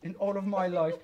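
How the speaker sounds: usual speaking voice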